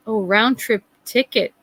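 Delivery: ordinary delivery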